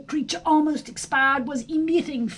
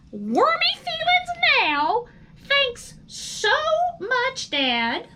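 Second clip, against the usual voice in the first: put-on high, louder young worm voice, sarcastic; a sulky son mocking his dad's sad story; indignant young worm; 11 semitones higher, 4 dB louder than usual. Son worm complains to his father.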